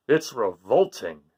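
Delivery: slow low disgusted father. Acted. Father reacting to Gregory's food, slow and disgusted. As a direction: drag it out in disgust. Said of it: "said Father Goat".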